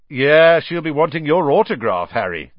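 loud, high, gleeful ribbing; grinning tease. A narrator's reading. Brother teasing the famous guest, grinning and loud. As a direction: crow it with a grin. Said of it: "grinned Fred".

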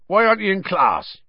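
big, gruff friendly giant; Hagrid voice. Acted. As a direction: speak as the kindly giant. Hagrid's voice in the story.

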